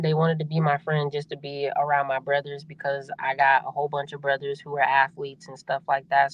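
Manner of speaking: ordinary delivery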